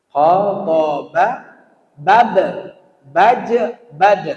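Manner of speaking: "qa", "ba", "ja", "dal" with a bouncing stop; bouncing qalqalah. Shown as a demonstration. Teacher demonstrates the five qalqalah letters. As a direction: bounce each letter sharply. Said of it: "Huruf qalqalah".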